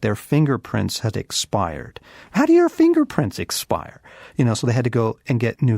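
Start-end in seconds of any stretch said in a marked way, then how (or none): 2.3-4.0 mock disbelief, pitched way up and louder; incredulous. Pastor mocking the bureaucrats, high incredulous squeak. Incredulous question about expired fingerprints.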